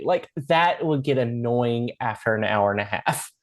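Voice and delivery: ordinary talk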